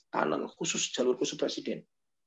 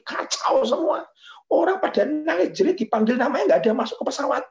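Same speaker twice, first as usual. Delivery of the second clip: high, loud frantic complaint; exasperated officer; 9 semitones higher, 4 dB louder than usual. Retold or imitated. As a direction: airport chief pleading about wrecked flights. Officer complaining about chaos. (kepala bandara mengeluh panik dengan suara tinggi)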